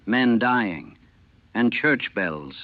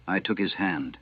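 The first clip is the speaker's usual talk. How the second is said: usual speaking voice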